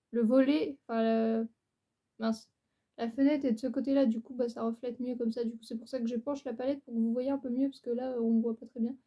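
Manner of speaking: ordinary delivery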